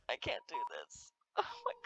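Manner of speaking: barely audible I can't do this; faded, defeated whisper. Real, not acted. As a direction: give up under your breath. Overwhelmed by the scene.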